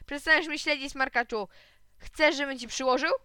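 loud, high threatening yell; angry shout. A narrator's reading. Older boy threatening the spy, shouting. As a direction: bark at the little kid to back off. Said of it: "i krzyknął".

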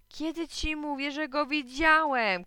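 high, drawn-out insistent cry; exasperated child insisting; loud, slow high protest; shouting. A narrator's reading. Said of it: "krzyknął Joachim".

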